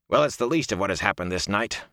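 plain reading voice

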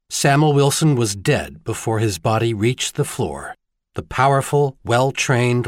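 ordinary delivery, nothing marked

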